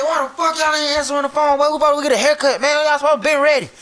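high, loud scolding tirade; imitated mad dad. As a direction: rant like an irate father confronting his kids.

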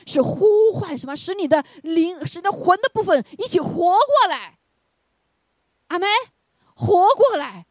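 high, loud, slow call to come alive; fervent shout; preaching at full force. Acted. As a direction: drive it home to the congregation. Preaching climax.

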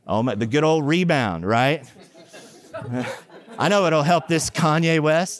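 ordinary talk

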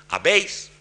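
"Habéis" lifted high and rising; high accent on habéis. Shown as a demonstration. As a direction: jump up on "Habéis". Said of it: "el de habéis".

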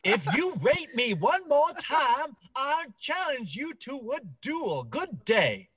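a hint of a huffy Southern gentleman. Mocking a senator, a mild genteel Southern huff. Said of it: "vaguely polite Southern".

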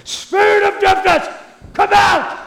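put-on high, loud shouted command at a deaf boy; mock faith healer shout, high and loud; shouting as loud as possible. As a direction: bellow it with all you've got, imitating the TV healer. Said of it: "I shouted as loud as I could".

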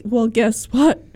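ordinary talk, nothing marked

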